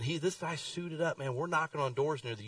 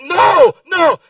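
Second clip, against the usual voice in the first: loud, high panicked "No, no"; panicked shout; 22 dB louder than usual. Retold or imitated. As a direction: yell it out in a panic. Panicked refusal at the door.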